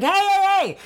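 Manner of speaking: high-pitched acted objection; hammed-up indignant retelling; mock protest. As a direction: cut in like an affronted girlfriend stand-in. Acted protest at unwanted kiss.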